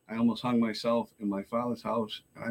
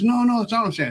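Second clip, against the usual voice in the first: higher, louder retold protest; mock defensive backpedal; 11 semitones higher, 5 dB louder, faster than usual. Gym worker backpedals to the man he offended, mock defensive. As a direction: imitate someone hastily taking it back.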